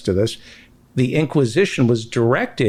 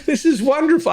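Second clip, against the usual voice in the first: laughing; faster than usual. Real, not acted. Host laughing delightedly.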